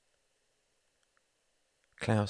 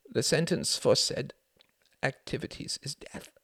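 halting, dry-mouthed higher croak; 8 semitones higher than usual. A narrator's reading. Klaus reads the death sentence, dry croak. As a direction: croak it out with no saliva. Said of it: "he croaked".

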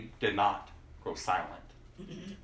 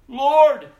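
crying out; 14 dB louder than usual. Acted. Blind men answer Jesus.

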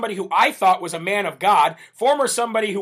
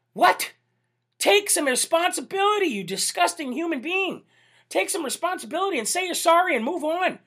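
higher-pitched, exasperated scolding; indignant outburst; 8 semitones higher than usual. Real, not acted. Host scolds politician for dodging blame, indignant outburst. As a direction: scold him in raised, incredulous pitch.

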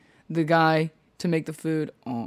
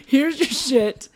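higher, louder and very slow; mock fed-up; 10 semitones higher, 5 dB louder, much slower than usual. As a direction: hand it over like you're fed up. Sam shoving their stuff back.

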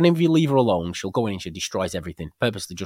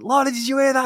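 excited question; 5 dB louder than usual. Retold or imitated. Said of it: "I was like".

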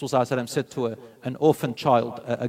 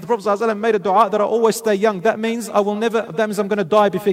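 higher, louder, rushed worry; acted-out alarm, pitched up and louder; upset protest; 6 semitones higher, 6 dB louder, faster than usual. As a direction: retell a girl's distressed complaint, without real tears. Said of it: "So she went and cried".

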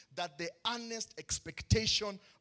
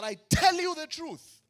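higher, louder voicing of Jesus; proclaiming scripture; 9 semitones higher, 8 dB louder than usual. Preacher quoting Jesus to the church, loud and lifted. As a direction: ring out the words of Jesus.

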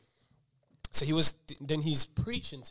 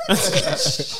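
loud and exasperated; 3 semitones higher, 15 dB louder than usual. Retold or imitated. Telling preacher to calm down.

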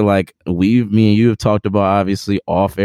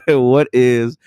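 laughing; 8 semitones higher than usual. Real, not acted. Laughing while asking question.

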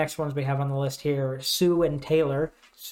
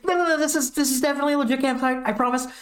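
much higher, fast and jittery; frantic high denial; 9 semitones higher, faster than usual. Retold or imitated. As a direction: rush the denial in a panic. Nervous cat quoted.

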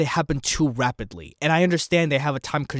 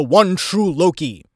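excited build; 5 semitones higher, 8 dB louder, slower than usual. Real, not acted. Building an evil Loki theory.

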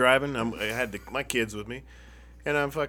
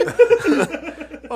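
loud, high giggle; laughing at discovering his headphones extend; 11 dB louder than usual. Real, not acted. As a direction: crack up at himself.

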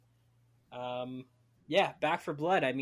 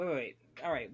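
ordinary talk, nothing marked

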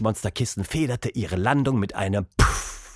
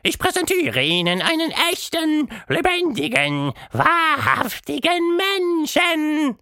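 grand showman monster; 3 dB louder than usual. A narrator's reading. Quatschmonster presenting Tilda to the mouse, grand showman voice. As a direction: present the marvel with a big flourish.

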